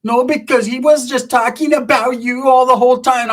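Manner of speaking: high, whining mimicry; Sheila. Sheila arguing back at Changa, high mimicking voice. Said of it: "she was like".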